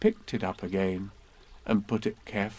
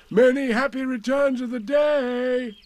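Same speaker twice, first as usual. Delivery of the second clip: high, loud cheerful call across the river; 13 semitones higher, 5 dB louder than usual. Acted. Pooh calling birthday wishes to Eeyore across river, loud. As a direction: shout the greeting over the water. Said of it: "called out Pooh".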